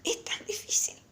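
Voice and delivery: soft whisper; hushed, voiceless aside. Real, not acted. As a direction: whisper it under your breath. Whispered aside during the lesson.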